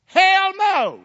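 loud, high-pitched shouted refusal; defiant shout. Acted. Preacher telling Satan off, defiant shout. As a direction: shout it back at the devil.

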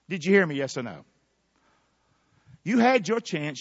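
usual speaking voice

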